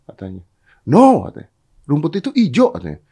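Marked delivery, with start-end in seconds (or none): 0.8-1.2 high, loud refusal; tiger's loud protest. Acted. Tiger objects. 1.8-2.7 1 role. Acted. Tiger insists.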